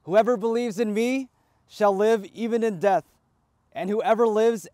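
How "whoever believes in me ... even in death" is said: ordinary delivery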